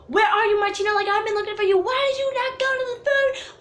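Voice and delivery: yelling. Retold or imitated. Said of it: "and yelling at me".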